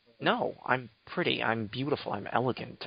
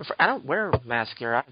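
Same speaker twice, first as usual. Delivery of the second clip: ordinary talk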